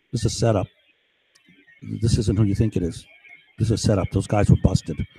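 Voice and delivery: subtle suggestion. Retold or imitated. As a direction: slip the warning in low, like a planted thought. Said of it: "I'm, like, saying this, you know, subtly".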